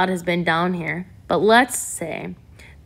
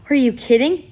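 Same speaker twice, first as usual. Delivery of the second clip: bright, excited disbelief, higher and louder; happy; 7 semitones higher, 4 dB louder than usual. A narrator's reading. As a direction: burst out with delighted surprise. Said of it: "Yvonne said happily".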